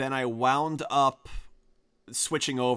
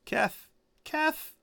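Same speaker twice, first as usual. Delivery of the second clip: high sing-song calling of a name; 4 dB quieter than usual. Real, not acted. Calling out to silent caller.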